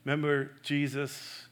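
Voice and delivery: usual speaking voice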